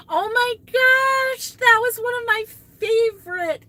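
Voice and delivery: high, loud gushing delight; excited squeal. Real, not acted. Excited memory of favorite episode.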